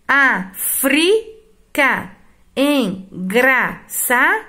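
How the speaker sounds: each word stretched slowly with pauses; slow example words. Shown as a demonstration. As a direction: model the words slowly for pupils. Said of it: "como o caso de claro". (exemplos ditos bem devagar)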